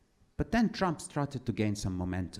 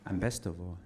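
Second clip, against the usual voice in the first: normal talking voice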